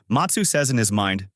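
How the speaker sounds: ordinary delivery, nothing marked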